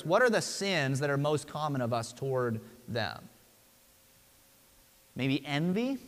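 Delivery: ordinary talk, nothing marked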